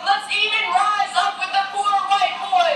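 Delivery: spoken-word poem, pitched above normal speech; poem recitation. Acted. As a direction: recite it as a rousing public poem. Recites own poem on stage.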